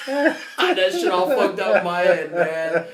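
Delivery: cracking up at his own confusion; laughing. Real, not acted. Laughing at memory.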